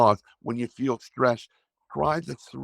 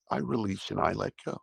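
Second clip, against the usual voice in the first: softer, spoken as the affirmation on the out-breath; quiet affirmation; 8 dB quieter than usual. Shown as a demonstration. Coach saying the affirmation on the breath, softly. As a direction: let go as you say it, hushed. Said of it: "Take a normal breath and say".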